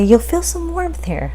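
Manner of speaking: gently coaching the patient; mimicking the tech. Technician warning the patient about the dye, mock soothing.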